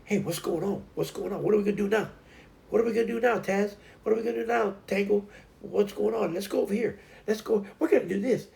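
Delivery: high, chirpy, excited dog voice; eager cheerful pup, pitched high; Honey. Retold or imitated. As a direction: bubble over cheerfully in a high little voice.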